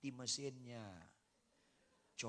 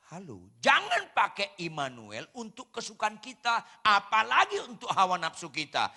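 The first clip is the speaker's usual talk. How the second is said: loud, high preacher's cry; preaching at full force; 7 semitones higher, 14 dB louder than usual. Acted. Pastor warning the congregation against misuse, preaching loud. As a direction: press the warning hard on the congregation.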